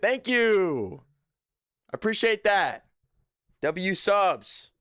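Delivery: loud, high, drawn-out hype thanks; excited shout-out. Real, not acted. Streamer thanks new subscribers, excited shout-out. Hyping viewers who just subscribed.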